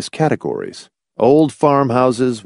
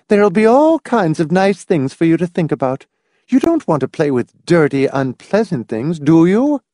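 lightly voiced mother, higher pitch; reproving mother; 8 semitones higher than usual. A narrator's reading. Mother steering son to nice things, mildly reproving. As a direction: coax and reprove a child.

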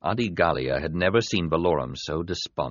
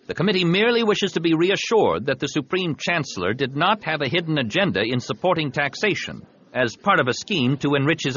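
higher-pitched, emphatic senator's address; high, declamatory courtroom voice; formal committee advocate; 7 semitones higher than usual. Acted. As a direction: put the case insistently to the judges. Voices Antilles addressing the judges.

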